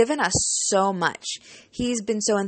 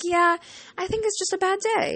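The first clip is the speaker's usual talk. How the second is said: high reassurance; 11 semitones higher than usual. Retold or imitated. Reassuring the teacher.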